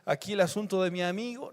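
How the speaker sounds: hushed, murmured aside prayer; soft and low, a private casual request. Acted. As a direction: pray it quietly, almost to yourself. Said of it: "dijo así en silencio, en voz baja".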